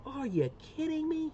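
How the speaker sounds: very high, put-on son voice; son's voice. Dad showing viewers how his son talks, high imitation. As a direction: imitate your boy in a high pitch. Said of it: "that's exactly how he talks".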